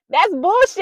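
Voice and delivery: sudden loud, high burst of real outrage; heated outburst. Real, not acted. Vlogger ranting to viewers about going back to exes, heated shout. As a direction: blurt it out hot and loud, fed up. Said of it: "Sorry, I got a little heated".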